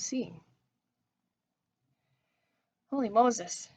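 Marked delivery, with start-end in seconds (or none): 2.9-3.7 loud startled yell; shouting. Acted. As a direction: yell it out in shock. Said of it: "I yelled".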